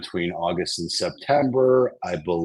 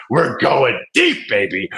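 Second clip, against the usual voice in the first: hyped up; 3 semitones higher than usual. Real, not acted. Hyping the viewer.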